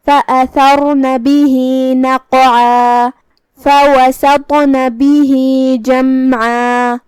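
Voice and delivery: slow, drawn-out melodic tajweed chant; Quran recitation. Shown as a demonstration. Teacher recites the verse to students in a slow melodic chant. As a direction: recite each word slowly and melodically, letting the vowels ring.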